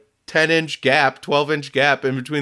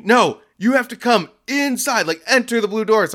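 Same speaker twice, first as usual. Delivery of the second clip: yelling; 9 semitones higher than usual. Retold or imitated. Customer yelling at the delivery driver to come in, yelling. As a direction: imitate an exasperated man yelling instructions. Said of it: "he's, like, yelling at me".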